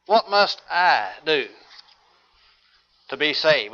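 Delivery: slow, with "I" set apart and leaned on; weight "I", pause after "do"; stress on I. Shown as a demonstration. Preacher showing the question is personal, stress on I. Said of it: "puts emphasis on the individual".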